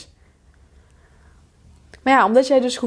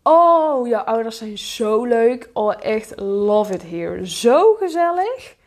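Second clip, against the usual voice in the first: put-on enthusiasm with long, swooping vowels; gushing guests; 2 semitones higher, 3 dB louder, slower than usual. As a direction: coo over them in an exaggerated way. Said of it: "En dan komen mensen naar je toe".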